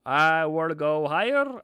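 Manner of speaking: high, bright "Hello" from the chart, then eager; chart voiced as a person. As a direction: give the chart a cheeky voice. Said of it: "start saying".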